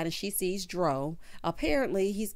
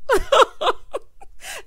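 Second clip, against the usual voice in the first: high cackle; howling laugh; shrieking laugh; 9 dB louder than usual. Real, not acted. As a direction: burst out laughing.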